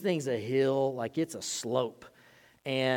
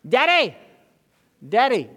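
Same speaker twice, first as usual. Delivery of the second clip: put-on high, loud whiny child calling daddy; kid whining for daddy; 8 semitones higher, 10 dB louder than usual. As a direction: whine it like a sleepy kid at night. Little one walks in 1 AM.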